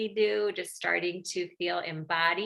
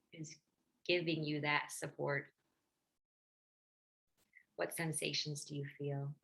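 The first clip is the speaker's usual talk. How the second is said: guided meditation; 2 semitones lower, 6 dB quieter than usual. Real, not acted. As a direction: gently guide listeners inward, leaving space between phrases.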